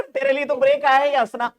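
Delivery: ordinary talk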